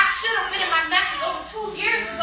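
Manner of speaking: high, loud testifying cadence; preaching at full force. Acted. To the congregation, confessing her fear, preaching loud. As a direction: testify to the church with rising fervor.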